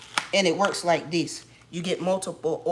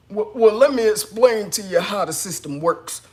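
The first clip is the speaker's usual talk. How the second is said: louder, smug explaining voice; mock condescending agent; 3 semitones higher, 9 dB louder than usual. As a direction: talk down like a know-it-all clerk. Mimicking the agent's lecture.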